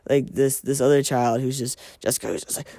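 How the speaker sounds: ordinary talk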